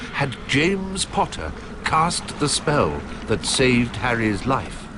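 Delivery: ordinary delivery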